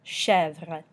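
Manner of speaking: Chèvre as "shev-ruh", open eh; French eh sound. Shown as a demonstration. Teacher models French for goat, eh sound in Chèvre. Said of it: "makes the eh noise in French".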